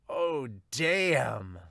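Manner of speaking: ordinary talk, nothing marked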